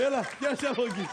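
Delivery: quick, high, loud call. Acted. Man calling to his helper during the lift, loud. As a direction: rattle it off loudly to a helper. Calls to helper while lifting.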